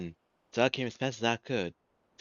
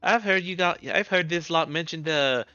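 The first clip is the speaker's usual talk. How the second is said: higher and louder, eager; high lively girl voice; 6 semitones higher, 6 dB louder than usual. Acted. Typic pestering Wild about the final days, high and eager. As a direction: pester him with eager questions. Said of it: "Typic is just like".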